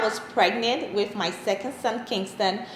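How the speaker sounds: ordinary talk, nothing marked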